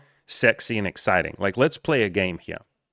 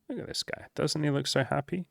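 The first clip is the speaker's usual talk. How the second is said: awed whisper; 8 semitones higher, 5 dB quieter than usual. Acted. Showing a photo of a happy monk.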